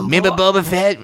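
Cartman voice. Retold or imitated. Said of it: "Why am I doing it in the Cartman voice?".

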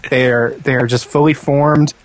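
normal talking voice

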